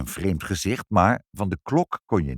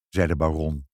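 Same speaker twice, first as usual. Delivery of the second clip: ordinary delivery, nothing marked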